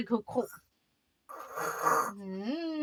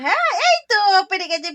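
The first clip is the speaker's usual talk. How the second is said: laughing praise; 5 semitones higher, 7 dB louder, faster than usual. Real, not acted. Toddler finds the picture.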